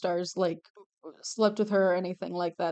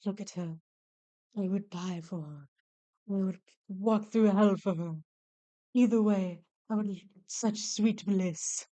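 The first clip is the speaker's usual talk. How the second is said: Gomez Addams quote. Retold or imitated. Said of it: "that Gomez Adams quote".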